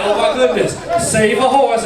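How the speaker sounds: a camp, over-the-top apology. Retold or imitated. Scared man apologizing to cowboy, camp affectation.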